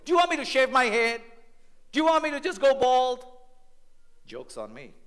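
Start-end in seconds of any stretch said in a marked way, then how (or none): 0.1-3.3 loud, high, fast retort; sarcastic teen. Retold or imitated. Teenager sassing parents about long hair, loud. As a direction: throw it back at your parents. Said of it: "You know what I used to always say?".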